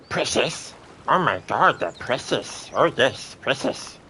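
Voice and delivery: a quick rough try at the ring-obsessed creature; half-assed Gollum. Shown as a demonstration. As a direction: Gollum muttering over his precious. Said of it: "that's me just trying to do a half-assed attempt at it".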